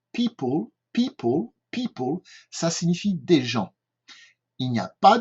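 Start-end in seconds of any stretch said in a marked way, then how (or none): 0.1-2.2 "people" said as "pi-pol"; model pronunciation. Shown as a demonstration. Teacher models how to say "people". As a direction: say "people" clearly with a long "pi". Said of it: "la prononciation est bien people".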